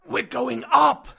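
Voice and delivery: 大声で叫ぶ; shouted warning. Acted. Uncle calls over rushing water.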